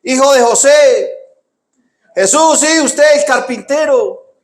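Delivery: very high, drawn-out hailing call; acted yell to get his attention; imitated crowd shouting. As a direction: holler like someone in a crowd wanting favours. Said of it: "y, y gritaban".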